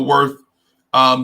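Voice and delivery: ordinary delivery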